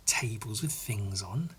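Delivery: soft whispered aside. Real, not acted. Noticing a table in the art, whispered. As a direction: breathe it to yourself.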